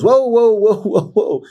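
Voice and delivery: high, loud mock whoa to stop the fight; therapist calling whoa. Therapist halting the couple's fight, loud high whoas.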